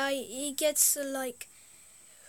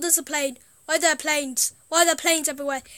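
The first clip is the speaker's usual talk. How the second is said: usual speaking voice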